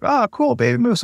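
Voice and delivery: high delighted exclaim; thrilled to spot a baby moose; excited. Retold or imitated. Dad spotting a baby moose, high and excited. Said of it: "And I was like".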